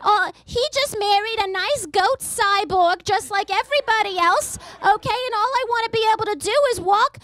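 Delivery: high, fast mock-outraged mother; ranting mom. Mother dreaming of her son's wedding, high comic rant. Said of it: "I'll be like".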